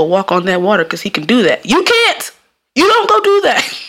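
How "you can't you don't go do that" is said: high-pitched laughing protest; comic exasperation. Real, not acted. Warning listeners off walking on water, high comic exasperation. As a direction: send the voice way up, breaking into laughter.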